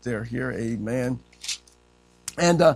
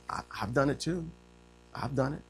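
usual speaking voice

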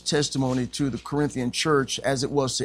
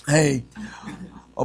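ordinary talk, nothing marked

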